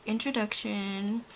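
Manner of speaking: normal talking voice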